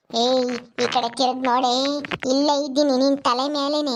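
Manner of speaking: a deep, menacing ghost calling from above; low ghost voice. Acted. Ghost Rukku taunting a terrified Shilpa, low menacing voice.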